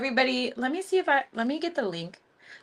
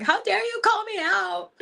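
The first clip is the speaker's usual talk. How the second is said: mock outrage; 12 semitones higher, 5 dB louder than usual. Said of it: "she would be like".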